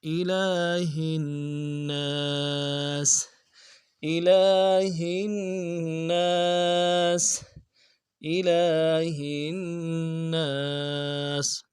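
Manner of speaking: slow melodic tajweed recitation; Quran recitation. Shown as a demonstration. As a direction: chant it slowly with full tajweed. (قرآن کی تلاوت، آہستہ اور سریلی)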